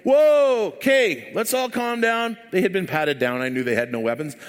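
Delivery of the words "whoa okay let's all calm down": calling for calm. Retold or imitated. Door worker to two men about to fight, calling for calm. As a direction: break up a fight, high then slowing. Acting himself breaking up a fight.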